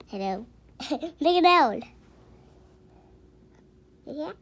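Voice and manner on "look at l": loud, laughing exclamation; laughing delight. Real, not acted. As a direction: laugh as you point it out.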